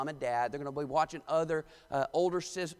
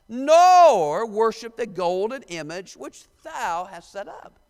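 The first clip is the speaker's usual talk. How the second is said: slow, loud, declaiming the verses; dramatic scripture reading; 2 semitones higher, 9 dB louder, much slower than usual. Acted. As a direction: proclaim the King James words like a preacher. Reads scripture aloud.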